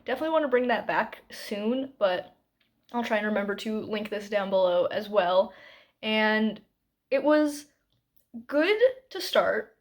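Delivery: normal talking voice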